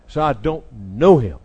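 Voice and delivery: loud, emphatic mock oath; cursing denial. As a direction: swear it word by word, loudly. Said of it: "And now he cursed, swore".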